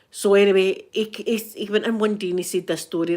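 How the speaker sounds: usual speaking voice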